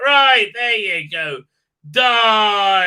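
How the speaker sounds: loud, drawn-out roar on the last word; mock battle cry, slowing down; mock killer's yell. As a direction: belt it out like a hammed-up executioner. Acting out a throat-slashing killing.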